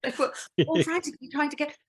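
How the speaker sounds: high, breathy gasping for air; mock breathless. Shown as a demonstration. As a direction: talk as if a tight corset leaves no air. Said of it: "because nobody could breathe in the air".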